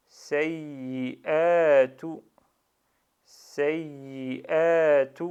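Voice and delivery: loud, slow "Sayyi'atu" leaning on the doubled y; pressed double y. Shown as a demonstration. Teacher modelling shadda on ya, "Sayyi'atu" with a held double y. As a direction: press hard and linger on the yy in "Sayyi'atu". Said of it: "J'appuie bien sur shadda de ya".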